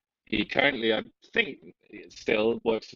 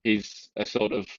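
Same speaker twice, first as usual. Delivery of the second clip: ordinary talk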